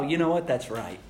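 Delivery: low, soft, dawning agreement; hushed pretend yes; quiet agreement. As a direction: murmur it as realization sinks in. Imagined listener agreeing softly.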